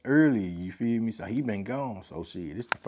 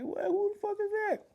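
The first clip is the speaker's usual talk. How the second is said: much higher, startled "who the fuck is that"; shocked outburst. Retold or imitated. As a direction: react like you just saw a mob coming down the street. Crowd reacting to approaching mob.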